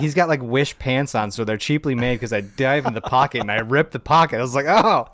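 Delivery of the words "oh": high, loud exclamation of surprise; startled oh. Retold or imitated. Officer rips suspect's pocket mid-search, startled. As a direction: react as if caught out by an accident. Retold shock at ripping the pocket.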